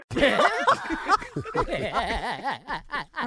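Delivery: long, rippling laugh; hearty laughter. Real, not acted. Radio crew reacting to the prank call, hearty laughter.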